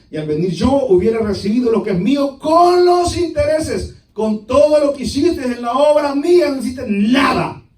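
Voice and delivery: high, forceful preaching shout; stern rebuke. Acted. Master rebuking the servant over his money, stern shout. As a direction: raise the voice in stern reproach.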